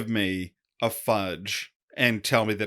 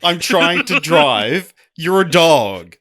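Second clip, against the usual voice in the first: slow, much louder exasperated rant; grumpy Shaggy; 6 semitones higher, 11 dB louder, much slower than usual. Retold or imitated. Co-host mocks Shaggy scolding Scooby, loud and slow. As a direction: spell it out to a dumb dog. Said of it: "and he's like".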